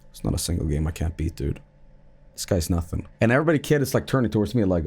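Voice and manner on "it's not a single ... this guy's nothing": low, soft, smug brag; cocky. Retold or imitated. Bragging to the kids, low and smug. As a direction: brush it off like a pro. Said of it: "Brush off my shoulders and I go".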